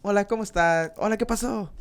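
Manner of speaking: high, uppity Spanish greetings. Shown as a demonstration. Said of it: "It sounds higher pitch".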